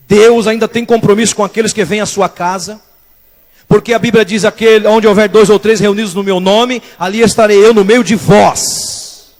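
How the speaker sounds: loud, emphatic preacher's delivery; forceful preaching. Acted. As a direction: proclaim the scripture to the whole church.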